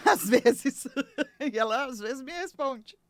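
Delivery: laughing through the words. Real, not acted. Laughing about chatting with her fridge. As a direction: chuckle at her own joke.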